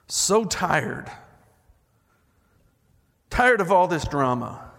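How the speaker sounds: lyrics read out like a sermon, rising and falling; dramatic lyric reading. Acted. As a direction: preach the song lyric, savouring each line. Preacher reads the song lyric aloud.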